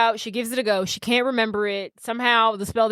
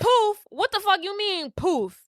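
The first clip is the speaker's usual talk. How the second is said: high-pitched disbelieving question; indignant, high retort; incredulous; 8 semitones higher than usual. Retold or imitated. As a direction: throw the word back in outrage, voice jumping up. Marnie voiced, incredulous at news.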